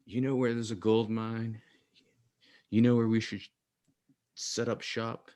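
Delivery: playing Bezos with a hammed-up Southern twang; mock Southern accent. Podcast host mocks Jeff Bezos pitching the board, hint of Southern accent. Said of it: "doing a Southern accent".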